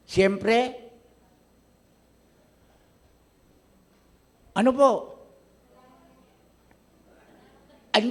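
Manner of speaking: high, loud, driving sermon voice; preaching at full force. Acted. Pastor pressing the congregation about an enemy, full-force preaching. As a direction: push the story hard at the congregation.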